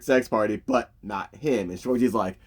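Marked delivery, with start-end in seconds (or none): none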